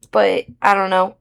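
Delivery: ordinary delivery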